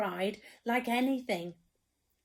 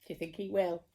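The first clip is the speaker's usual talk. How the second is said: ordinary delivery, nothing marked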